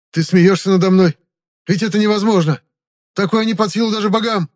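very high, loud protest; shrieked, desperate astonishment; incredulous outcry. Acted. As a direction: object in frantic disbelief. Captive cries out in disbelief.

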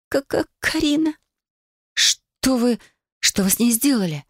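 high, breaking with panic; frightened. A narrator's reading. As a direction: blurt it out in fear. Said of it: "Слёз было не удержать".

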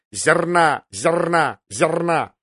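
squawked cry with a rolled r; raven's shriek. A narrator's reading. Raven crying out during the fight, squawking. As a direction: cry it out like an alarmed bird. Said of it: "вскрикнул ворон".

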